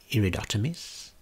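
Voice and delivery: ordinary delivery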